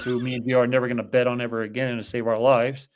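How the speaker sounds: usual speaking voice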